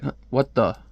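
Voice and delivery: ordinary delivery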